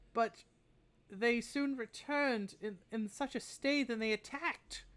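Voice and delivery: put-on a troubled old woman recounting an attack, pitched high; weary old woman. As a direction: Maribel tells Tori about the creatures, high and weary. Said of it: "She kind of sighs a bit".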